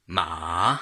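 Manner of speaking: ma said low, dipping then rising; third tone ma. Shown as a demonstration. Teacher demonstrating ma in Mandarin third tone. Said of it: "falling and then rising".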